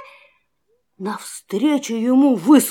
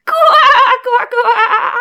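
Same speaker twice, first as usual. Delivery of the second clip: high croaking laugh; 9 semitones higher, 5 dB louder than usual. Acted. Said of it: "засмеялась лягушка".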